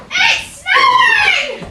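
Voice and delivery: high, drawn-out excited cry; excited shout. Acted. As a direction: shout it with delight. Child excited at first snow.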